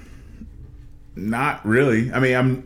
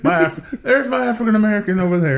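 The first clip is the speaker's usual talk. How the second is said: imitating Trump; 10 semitones higher, 4 dB louder than usual. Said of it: "repeats Trump quote".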